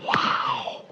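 hushed, voiceless wow; awed whisper. Real, not acted. Preacher marvelling at two bema seats, awed whisper. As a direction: breathe it out in wonder.

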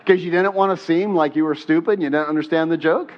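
normal talking voice

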